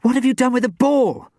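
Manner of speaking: urgent breathless question. A narrator's reading. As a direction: ask it in a rush, out of breath. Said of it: "she cried breathlessly".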